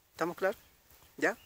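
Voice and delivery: ordinary talk, nothing marked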